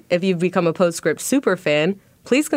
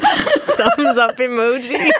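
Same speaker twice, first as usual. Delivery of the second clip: laughing; 6 semitones higher, 3 dB louder, much slower than usual. Real, not acted. Laughing while repeating the email.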